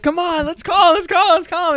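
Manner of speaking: high, pleading push to call; excited crowd-pleading voice; eager urging. Retold or imitated.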